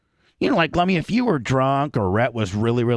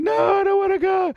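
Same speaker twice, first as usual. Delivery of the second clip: high falsetto wail; mock tearful Erica. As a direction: imitate a panicked, crying refusal. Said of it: "making you cry".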